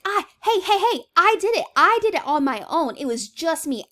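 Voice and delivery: high, loud claim of credit; insistent boast. Acted. Claiming to have done it alone, insistent.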